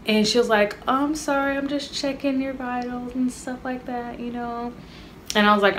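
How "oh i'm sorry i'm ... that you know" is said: higher, softer nurse voice, lightly put on; mock-sweet apology as the nurse; a light impression of the nurse apologising; imitating the nurse.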